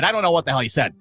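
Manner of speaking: normal talking voice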